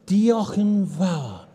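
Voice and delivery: "Diolch yn fawr" slowed right down, with a throaty ch in "Diolch"; slow Welsh thanks. Shown as a demonstration. Leader teaching a congregation Welsh thanks, slow careful Welsh. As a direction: lead the room through "Diolch yn fawr" word by word, slowly. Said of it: "can we do that together".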